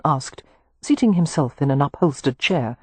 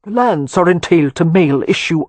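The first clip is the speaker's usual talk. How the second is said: put-on old earl's Anglo-Irish accent, louder; 6 dB louder than usual. Earl explaining to his grandsons, Anglo-Irish accent. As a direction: speak with proud Anglo-Irish gravity.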